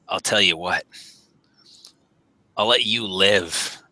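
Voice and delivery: spare them grandly, deep and slightly amused; deep, faintly mirthful. Acted. Masked figure lets the messenger live, deep voice with slight mirth. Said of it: "like there's some mirth in it".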